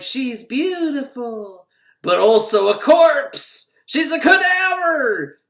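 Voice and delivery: high, slow, loud ghostly intoning; spooky onlooker. Retold or imitated. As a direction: drone it like a ghoul. Onlookers at the pulled-out car.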